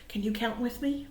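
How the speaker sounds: usual speaking voice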